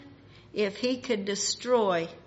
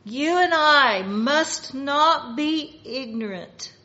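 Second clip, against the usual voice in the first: louder, emphatic preaching; speaking up; 4 semitones higher, 7 dB louder than usual. Real, not acted. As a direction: raise your voice so they can hear. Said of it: "I'll try to speak where you can hear me".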